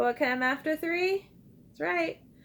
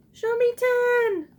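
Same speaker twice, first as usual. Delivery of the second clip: excited prompt; 10 semitones higher, 7 dB louder than usual. Real, not acted. Teacher cheerfully prompting kids.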